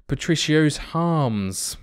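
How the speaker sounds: "arms" said as "harms", drawn out slowly; added H. Real, not acted. Commentator on a free kick, "arms" with an added H. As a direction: put a stray H on the front of "arms". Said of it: "I don't know why I added a H there".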